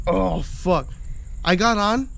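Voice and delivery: ordinary talk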